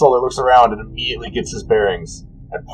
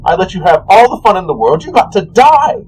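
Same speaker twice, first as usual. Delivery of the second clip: petulant god; 8 semitones higher than usual. Acted. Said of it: "he goes".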